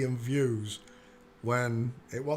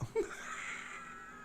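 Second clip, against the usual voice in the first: wild laugh; 9 dB quieter than usual. Real, not acted. Cracking up at the photo.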